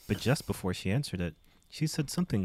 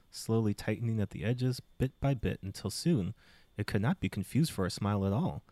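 usual speaking voice

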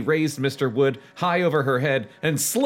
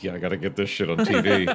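talent scout; 6 semitones lower, faster than usual. Pretend. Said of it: "The talent scout was like".

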